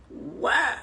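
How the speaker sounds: imitated "wah" sob after a gasp; short pretend bawl; mock crying. Shown as a demonstration. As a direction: fake a release of tears. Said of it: "you just need to cry".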